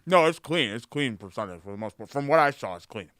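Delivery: talking through a mouthful; words around a bite of food; mouth full. Real, not acted. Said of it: "not talk with your mouth full".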